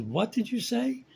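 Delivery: high, slow indignant question; a shocked young woman demanding he repeat it. Acted. Woman offended by pickup line.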